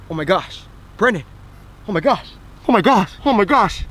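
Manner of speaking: mounting panicked exclamations, put on; mock alarm. Dad pranks his son about the scar, mock alarm. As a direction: repeat it with building fake alarm.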